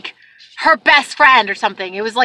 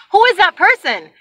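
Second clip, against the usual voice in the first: higher, insistent retold question; exasperated; 7 semitones higher, 4 dB louder than usual. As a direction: demand it, acting out your exasperation. Mock exasperated demand on retold call.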